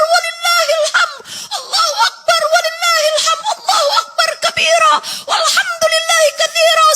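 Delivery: high, loud chanted takbir in tears; shouted takbir. Acted. Father and son praise God together, shouting takbir. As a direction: cry the takbir out with joy and tears.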